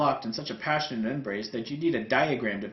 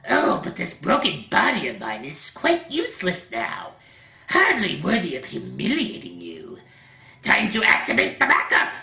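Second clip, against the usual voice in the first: higher, scheming villain voice; mad scientist villain; 5 semitones higher, 6 dB louder than usual. Acted. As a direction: scheme like a wounded madman. Villain plots, coughing.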